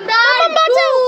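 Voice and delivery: put-on high-pitched child-character cry for rescue; child calling for help. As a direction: call out in panic as a little one. Acted child calls for rescue. (বাচ্চার সরু গলায় বাঁচানোর ডাক)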